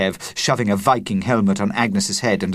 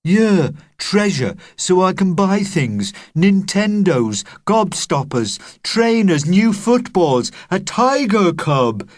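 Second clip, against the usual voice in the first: put-on higher, louder excited boy listing wants; excited cry; 10 semitones higher, 5 dB louder than usual. A narrator's reading. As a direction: rattle off the wish list with glee. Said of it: "cried Kev".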